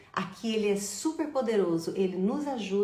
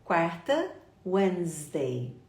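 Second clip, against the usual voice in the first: normal talking voice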